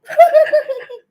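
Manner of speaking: loud, high-pitched cackle; big laugh. Real, not acted. Streamer laughs at menopause talk before newcomer, high cackle. Laughs at her own flustered remark.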